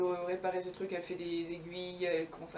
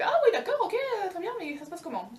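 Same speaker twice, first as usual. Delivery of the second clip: much higher, bright eager yes; excited girl; 11 semitones higher, 5 dB louder than usual. Acted. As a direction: leap at it, all enthusiasm. Blondine delighted.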